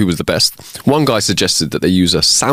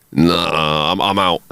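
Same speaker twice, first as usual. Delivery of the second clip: low, hesitant retreat; backing out; 4 semitones lower, slower than usual. Acted. Lion deciding against eating the dog, low and hesitant. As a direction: drop the pitch and bow out.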